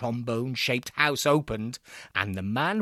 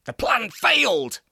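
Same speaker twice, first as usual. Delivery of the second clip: bellow; 9 semitones higher, 4 dB louder than usual. A narrator's reading. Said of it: "I bellowed at Botzen".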